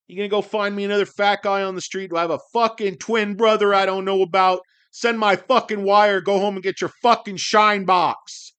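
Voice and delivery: worked-up rant. Acted. Streamer berating a bank over his wire, worked-up shouting. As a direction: lay into the bank clerk at full volume. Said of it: "getting so worked up".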